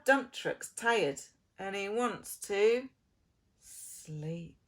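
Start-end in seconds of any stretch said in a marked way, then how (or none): none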